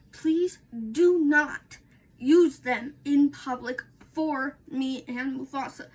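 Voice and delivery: slow, firm "please do not". Real, not acted. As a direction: lay down the rule. Firm plea to viewers.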